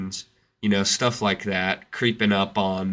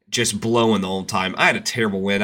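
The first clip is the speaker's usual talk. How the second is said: normal talking voice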